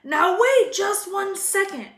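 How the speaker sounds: loud, slow, high outrage; furious giant. A narrator's reading. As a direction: hold them in place with fury. Hagrid in anger.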